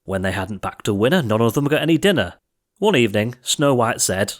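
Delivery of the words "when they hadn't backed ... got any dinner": ordinary delivery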